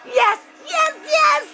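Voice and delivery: high, elated yes; excited yes. Real, not acted. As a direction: celebrate the equalizer. Fan celebrating a score.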